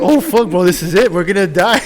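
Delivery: loud, high, hammed-up terror; mock panic. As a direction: act out panicking to your buddy, nearly shouting. Two boys panicking in car, retold.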